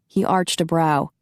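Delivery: plain reading voice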